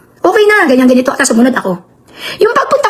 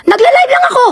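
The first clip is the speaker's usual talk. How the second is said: crying shout; 11 semitones higher than usual. Real, not acted. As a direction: shout it out, near tears, defending yourself. Defending herself in heated live rant. (pasigaw at halos umiiyak)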